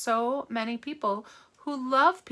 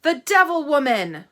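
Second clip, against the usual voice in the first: loud, higher accusing yell; brand her before the crowd; loud mob accusation; shouted accusation; 5 semitones higher, 10 dB louder than usual. Acted.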